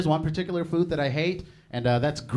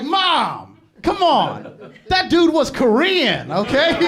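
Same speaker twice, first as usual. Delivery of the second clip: loud, high cry of protest at mom; exasperated shout; 11 semitones higher, 9 dB louder, much slower than usual. Retold or imitated. Son scolding his mom, loud laughing protest. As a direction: yell at her to stop, half laughing. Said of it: "And I was like".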